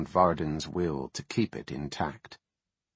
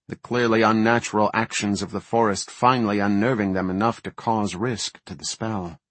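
plain reading voice

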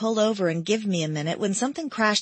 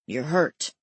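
a man's voice, low and sure; lower male voice; 5 semitones lower than usual. A narrator's reading. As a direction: drop into his lower register. Male character voiced by narrator.

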